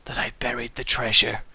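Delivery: hushed, breathless secret; mock last-breath whisper; dying whisper. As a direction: whisper the secret as life slips away.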